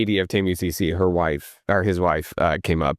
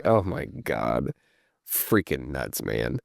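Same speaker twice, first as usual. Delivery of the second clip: low, quiet, drawn-out disbelief; worn-out amazement, dropping low under the breath; exasperated disbelief; 9 semitones lower, 6 dB quieter, much slower than usual. Real, not acted. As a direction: let it sink down, slow and soft. Exasperated at the coach's many violations.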